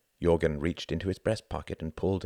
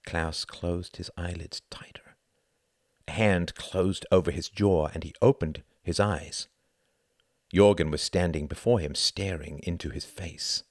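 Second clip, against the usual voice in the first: ordinary delivery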